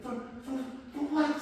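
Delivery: high, stammered disbelief; incredulous. Retold or imitated.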